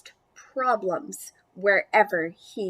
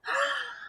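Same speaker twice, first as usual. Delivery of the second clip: gasp; 14 semitones higher, 5 dB quieter than usual. Acted. Lucy finds her pet missing.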